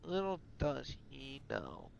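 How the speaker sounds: slow, hushed foreboding; ominous repeat. A narrator's reading.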